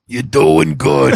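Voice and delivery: slow, drawn-out put-on voice; Brian. Friend reacts to house buying, slow imitation. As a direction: imitate the buddy, stretching each word. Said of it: "He went".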